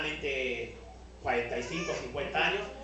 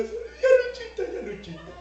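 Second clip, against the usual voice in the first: much higher tearful mock wail; imitating crying plea; 6 dB louder than usual. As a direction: whimper for mercy through a laugh. Woman begging while being scolded.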